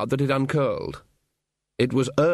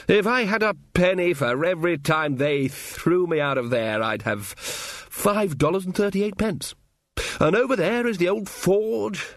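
higher, expansive fond storytelling; reminiscing old wizard; 4 semitones higher, slower than usual. Acted. Ridcully shows companions the town of his past, fondly nostalgic. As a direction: point out old haunts with lingering relish.

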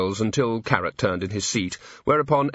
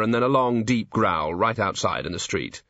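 ordinary delivery

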